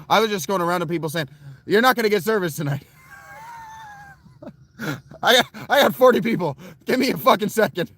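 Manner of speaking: high, loud, rushed frazzled rant; frantic overwhelmed. Retold or imitated. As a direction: blurt it out like she is drowning in tables. Frantic overwhelmed waitress retold.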